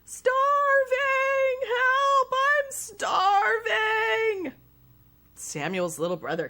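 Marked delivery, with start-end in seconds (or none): 0.0-4.5 loud, high, stretched-out wail; hammed-up starving yell. A narrator's reading. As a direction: cry out dramatically for food.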